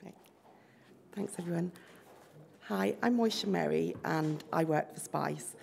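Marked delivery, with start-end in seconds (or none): none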